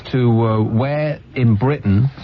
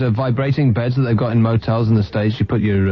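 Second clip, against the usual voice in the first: ordinary talk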